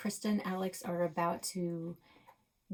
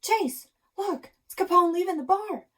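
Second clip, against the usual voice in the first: much higher, louder urgent call; high loud urgent shout; excited alert; 12 semitones higher, 9 dB louder than usual. Acted. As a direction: sound the alarm to your partner. Buck spotting Capone.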